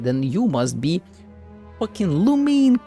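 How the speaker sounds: ordinary talk, nothing marked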